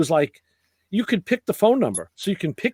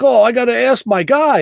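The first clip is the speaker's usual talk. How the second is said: quoting buddy; 7 semitones higher, 5 dB louder than usual. Retold or imitated. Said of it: "He's like".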